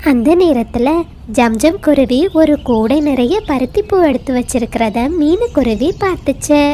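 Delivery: ordinary delivery, nothing marked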